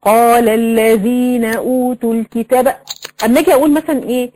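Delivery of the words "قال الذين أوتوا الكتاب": slow, level Quranic recitation; measured tajweed chant on one note; recited example. Shown as a demonstration. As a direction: recite it slowly with long, even vowels. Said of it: "لو أنا جيت مثلًا إيه".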